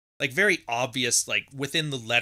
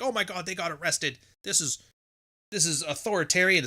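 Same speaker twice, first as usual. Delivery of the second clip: higher-pitched, hammed-up shock; a put-on cry of tyranny; mock outrage; 6 semitones higher than usual. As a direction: parody people crying about arrests. Mocking protest supporters' outrage.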